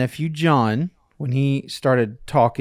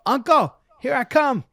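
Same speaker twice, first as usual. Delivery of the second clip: put-on high, excited little girl calling out; child's yell; 13 semitones higher than usual. Niece running to her uncle, higher excited call. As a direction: call out like a toddler galloping up. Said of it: "she yelled".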